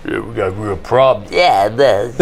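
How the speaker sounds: low mumbled tough-guy imitation; low tough-guy voices. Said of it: "It's like".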